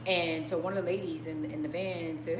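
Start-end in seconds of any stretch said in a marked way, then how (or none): none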